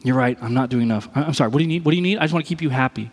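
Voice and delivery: lightly voiced anxious people-pleaser; mock timid apology. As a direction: cave in and appease nervously. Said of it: "a fear that could lead, like, codependence".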